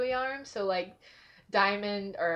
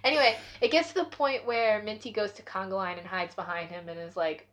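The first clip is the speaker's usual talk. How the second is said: ordinary delivery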